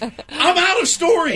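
exasperated. Real, not acted. Exasperated at having told everything.

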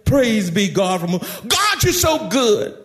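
high rapid-fire hallelujahs easing downward; ecstatic praise. Acted. Shouting John jumping and waving in church, acted ecstatic shouting. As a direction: act out a worshipper overcome with praise. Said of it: "all of a sudden he'd jump up".

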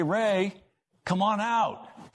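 high, slow call across the water. Retold or imitated. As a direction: call him up from the cave, drawn out. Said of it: "calling, 'Hey".

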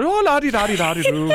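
high, singsong fake Irish lilt; mock Irish accent. Teasing the co-host's habit, mock Irish accent. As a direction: imitate a silly Irish accent in nonsense syllables. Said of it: "why do you gotta do the accent".

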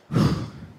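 loud, voiceless puff of breath; acted big breath out before a decision; heavy exhale. Acts solemn reaction before deciding.

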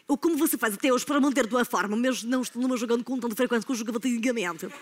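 put-on European Portuguese accent; Portugal accent. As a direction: ask it like someone from Portugal, vowels swallowed. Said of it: "Agora, em português de Portugal".

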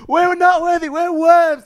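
high-pitched grovelling minion voice; loud, high cartoon wail; Pain and Panic. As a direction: squeal it like a cringing cartoon henchman. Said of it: "Pain and Panic from the Hercules films".

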